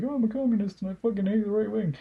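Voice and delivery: high, fast mocking voice; high sneering parody of a communist; mocking impression. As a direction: parody his self-righteous claim, high and quick. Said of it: "the guy who's like".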